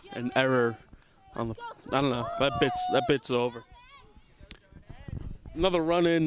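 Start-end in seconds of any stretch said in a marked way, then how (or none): 5.5-6.3 loud, high run call; excited call. Real, not acted. Calling a scored run.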